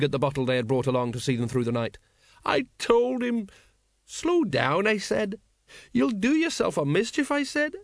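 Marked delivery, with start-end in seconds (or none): 2.4-7.7 much higher, slow fretful retelling; mild hammed-up fuss; anxious sergeant. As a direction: plead with the memory. Colon retelling his warning.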